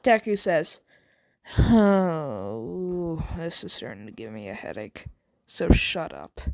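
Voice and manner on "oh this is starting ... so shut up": groan, then grumble low as if head hurts; weary annoyance. Acted. Deku groans about a headache.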